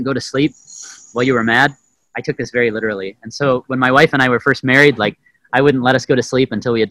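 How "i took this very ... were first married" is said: ordinary talk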